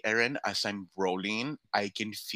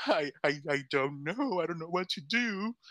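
high, faltering mock-tearful stammer; imitated crying; 7 semitones higher than usual. Injured performer asked to do the show, mock tearful. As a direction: stammer helplessly, pretending to be in tears. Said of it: "I was literally there crying".

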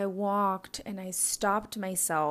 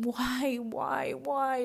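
1 anguished; 4 semitones higher, much slower than usual. Retold or imitated. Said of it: "I was just yelling".

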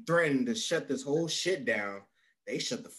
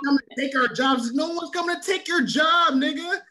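loud, high mocking rant; mock shout; 11 semitones higher, 5 dB louder than usual. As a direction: yell it like an angry MAGA uncle. Mocking MAGA family, shouting.

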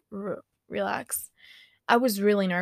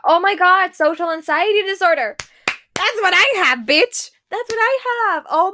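much higher and louder, thrilled; excited recognition; 10 semitones higher, 10 dB louder than usual. Real, not acted. Telling viewers she has social anxiety, excitedly. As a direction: exclaim that this is you.

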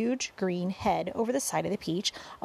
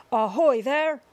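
loud, raised police chief's hail; shouting; 4 semitones higher, 7 dB louder than usual. A narrator's reading. Chief of police hails the travelers up on top, shouting. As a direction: call up to someone far above. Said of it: "shouted the chief of police".

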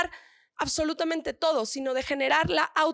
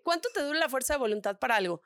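ordinary delivery

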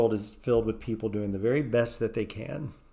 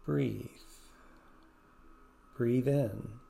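soothing guided meditation voice. Acted. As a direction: ease the listener through each breath.

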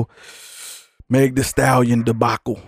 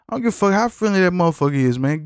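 fast angry rant; 7 semitones higher, faster than usual. Real, not acted. Ranting at dog owners.

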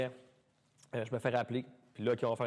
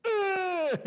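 high, loud gasp of shock; surprised yelp; 7 dB louder than usual. Real, not acted. Learning he got on the show, a high shocked yelp. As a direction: let out a startled squeal. Reaction to getting the show call.

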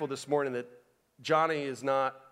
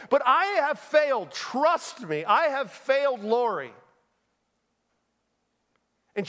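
raised, emphatic sermon voice; impassioned preaching; 8 semitones higher, 5 dB louder than usual. Real, not acted. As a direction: preach the confession firmly, punching key words. Preaches at full force.

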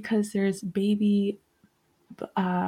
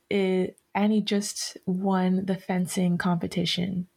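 ordinary talk

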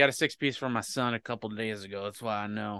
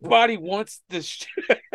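dismissive; 8 semitones higher, slower than usual. Retold or imitated. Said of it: "I'm like".

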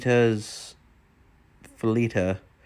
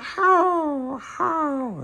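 much higher, yapping little dog voice; yippy dog imitation. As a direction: yap it out like a tiny yipper dog. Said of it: "it's like a yipper dog".

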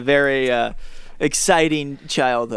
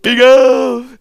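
high, loud strained gasp; gasping Big Al; 10 semitones higher, 9 dB louder than usual. Acted. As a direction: blast the name on a squeaky gasp. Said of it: "mine's hitting too low".

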